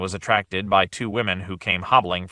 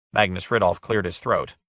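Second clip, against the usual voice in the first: ordinary delivery, nothing marked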